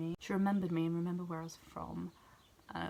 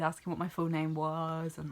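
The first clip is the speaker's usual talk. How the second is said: ordinary delivery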